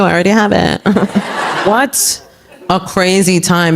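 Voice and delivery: ordinary talk, nothing marked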